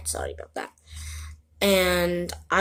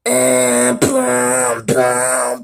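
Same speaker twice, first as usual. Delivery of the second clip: beatbox; 2 semitones higher, 4 dB louder, much slower than usual. Shown as a demonstration. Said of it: "and then I did".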